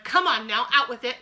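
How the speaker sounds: impatient demand. Acted. Mister Jenkins demands an answer.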